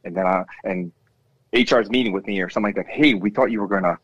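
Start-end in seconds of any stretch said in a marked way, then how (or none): none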